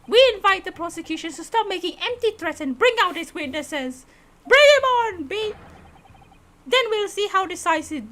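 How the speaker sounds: loud, confident courtroom pleading; bold defense lawyer. Acted. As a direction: challenge the prosecution head-on.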